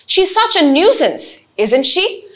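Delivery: slow statement, tag falls at the end; slow teacher demo of a confident tag question; falling tag question demo. Shown as a demonstration. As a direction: model a sure tag with a falling close. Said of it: "tag কোয়েশ্চেনে নেগেটিভটা প্রশ্ন করি".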